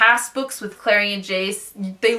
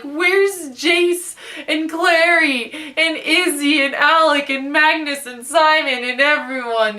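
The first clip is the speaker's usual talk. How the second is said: mock whining; 4 semitones higher, slower than usual. Reader missing her old favourite characters, mock whining wail. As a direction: wail the list of names, stretched out.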